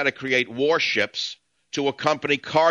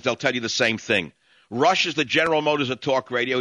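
ordinary talk, nothing marked

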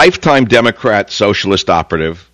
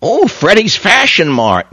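sarcastic; 11 semitones higher, slower than usual. Retold or imitated. Sarcastic mock exclamation.

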